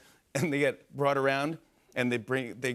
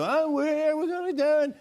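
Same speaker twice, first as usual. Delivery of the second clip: very high-pitched comedian impression; Jerry Seinfeld; 13 semitones higher, 3 dB louder than usual. Comic doing a bit for Seinfeld on live TV, hammed-up impression. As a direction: push it way up into a caricature squawk. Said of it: "I'll do an impression of Jerry Seinfeld".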